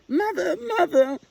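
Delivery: very high, loud tearful calling; a chick crying after a mother out of reach; sobbing call. A narrator's reading. Little Owl falling behind her mother, high tearful call. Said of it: "sobbed Little Owl".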